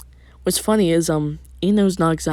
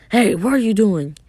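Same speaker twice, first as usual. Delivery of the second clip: raised, higher shout; calling out; 5 semitones higher, 6 dB louder than usual. Acted. Officer spots two people fleeing in the street, calling out. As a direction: challenge them loudly from a distance. Police officer calls to runaways.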